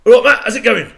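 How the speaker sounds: big cheeky football-terrace shout; shouted greeting. Retold or imitated. Mates in the crowd shouting to the singer, loud cheeky yell. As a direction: bellow it across the room at the stage.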